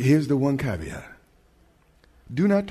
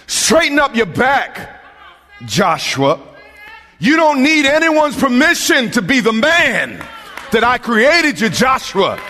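high, loud shouted preaching; fiery preacher shouting; preaching at full force; 10 semitones higher, 5 dB louder than usual. Acted. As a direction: build the sermon to a roar. Preaching crescendo.

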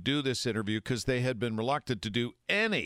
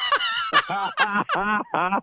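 laugh; 7 dB louder than usual. Real, not acted.

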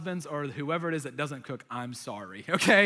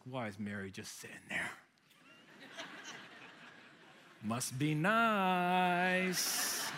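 resentful grumbling; 8 dB quieter, slower than usual. Retold or imitated. Martha alone in the kitchen about idle Mary, resentful. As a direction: grumble under it, stretching the last words slowly. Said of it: "and she's like".

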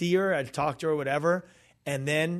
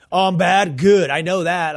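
loud, raised-pitch defiance; rebellious; 5 semitones higher, 13 dB louder than usual. Retold or imitated. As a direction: throw it back like a defiant rebel. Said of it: "like me, I rebelled".

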